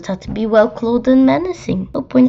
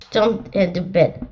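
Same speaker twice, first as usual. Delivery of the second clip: ordinary delivery, nothing marked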